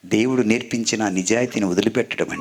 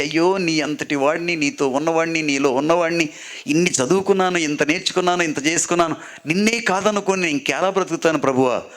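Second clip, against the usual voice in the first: weeping plea; 7 semitones higher than usual. Retold or imitated. Peter weeping to his Lord, pleading in a raised voice. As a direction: cry out like a broken disciple begging forgiveness. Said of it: "కన్నీళ్లతో ఏడ్చి ఏడ్చి".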